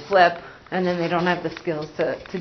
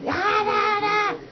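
imitated yelling; 13 semitones higher, much slower than usual. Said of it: "No words, just yelling".

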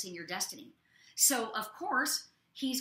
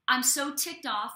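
ordinary delivery

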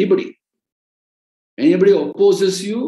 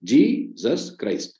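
slow, syllable by syllable; 3 semitones lower, 3 dB quieter, much slower than usual. Shown as a demonstration. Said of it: "Three syllables".

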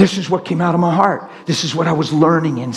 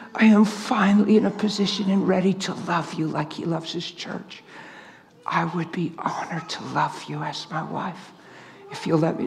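hushed, heartfelt promise, fading to a whisper; tender; 7 dB quieter than usual. Retold or imitated. Preacher retelling his promise to his wife, tender and hushed. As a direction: softly pledge your love, nearly breathless.

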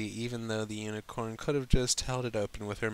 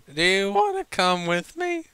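Applejack voice; 10 semitones higher, 6 dB louder than usual. Acted. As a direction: voice Applejack as a tipsy invitation. Applejack's drunken invitation voiced.

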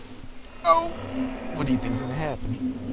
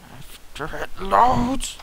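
slow high yell; loud drawn-out shout; 10 semitones higher, 9 dB louder, much slower than usual. Real, not acted. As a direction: belt it out as a joke. Joking sign-off.

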